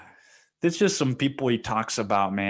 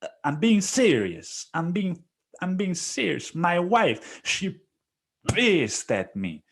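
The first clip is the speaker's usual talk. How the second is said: higher, insistent imitation of the boss; mock earnest boss; 9 semitones higher than usual. Boss swears he's serious about his angry wife, pitched up. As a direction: pitch it up and plead. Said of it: "And he's like".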